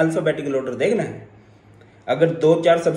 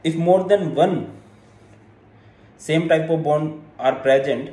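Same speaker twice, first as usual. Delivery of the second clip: slow, phrase by phrase with pauses; dictation; much slower than usual. Real, not acted. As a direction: read it out slowly for note-takers. Dictating a rule for note-taking.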